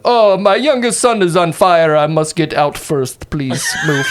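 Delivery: hammed-up alarm, calling out to clear a path; mock shouting. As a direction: play at shouting for people to move. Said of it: "He starts shouting to the crowd".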